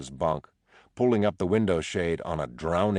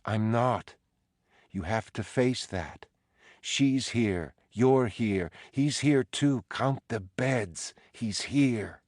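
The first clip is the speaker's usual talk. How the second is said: insistent ghostly wife; 6 semitones higher, slower than usual. Acted. Dolores insisting, voice climbing.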